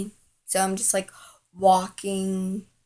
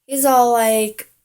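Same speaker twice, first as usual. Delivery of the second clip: usual speaking voice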